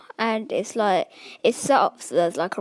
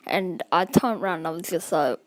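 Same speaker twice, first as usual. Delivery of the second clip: ordinary talk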